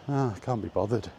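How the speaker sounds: ordinary talk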